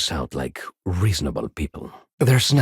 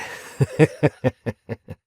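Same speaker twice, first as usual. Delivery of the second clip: scornful laugh; 7 semitones higher than usual. Acted. Scornful laugh about the authorities.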